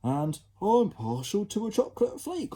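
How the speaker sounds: put-on high, prissy child voice; posh child. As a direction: spoilt kid remarking. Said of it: "remarked another".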